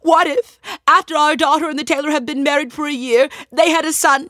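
high, loud wailing voice; crying wife. Acted. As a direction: wail it out, high and tearful. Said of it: "He started crying too".

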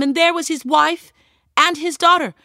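high, loud sobbing voice; crying. A narrator's reading. Wife and daughter found weeping.

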